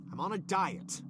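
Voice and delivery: ordinary talk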